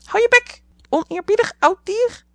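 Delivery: "Hou je bek" heel hoog en luid gekrijst als papegaai; papegaai na-apend. A narrator's reading. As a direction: aap de tante na als schelle papegaai. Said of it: "riep Polly". (papegaai Polly aapt tante na, heel hoog en luid)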